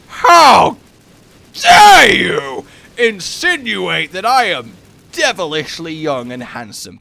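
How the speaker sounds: high, loud theatrical indignation, easing off; hammed-up offence, first words stretched and shouted; mock outrage. Customer retelling being asked for ID, mock outrage.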